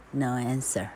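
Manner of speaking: plain reading voice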